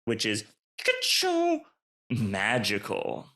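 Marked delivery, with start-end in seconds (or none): 0.8-1.6 very high squeaky "ka-choo" effect; magic whoosh sound, squeaky; high transforming zap sound. Shown as a demonstration. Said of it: "make a sound like".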